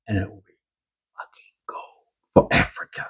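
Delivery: whispered, awestruck reveal; hushed reveal. Acted. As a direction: drop to a whisper as the vault opens. Hushed reveal of the opened vault.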